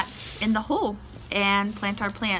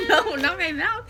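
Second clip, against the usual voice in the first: laughing protest; 11 semitones higher than usual. Real, not acted. Toddler puts worm near mouth.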